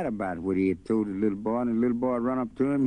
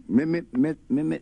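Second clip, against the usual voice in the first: high, loud stuttered mi-mi-mi; a boy stuck on the first sound; stammering; 7 semitones higher, 4 dB louder than usual. Acted. Stuttering boy straining at Mister.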